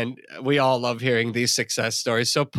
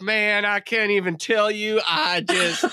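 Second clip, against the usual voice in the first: hammed-up thick Southern drawl, a touch higher; 7 semitones higher than usual. Buddy back from Nashville answers, in a hammed-up Southern drawl. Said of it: "the thickest Southern drawl".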